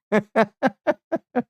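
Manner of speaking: high-pitched giggling; a run of high, amused chuckles; laugh. Real, not acted. Streamer laughing at own gatekeeper bit, high giggle.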